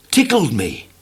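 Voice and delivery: plain reading voice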